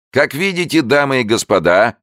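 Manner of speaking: high, loud hoarse barker; hoarse showman. A narrator's reading. Showman continuing to the crowd, high hoarse barker voice. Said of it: "продолжал человечек хриплым голосом".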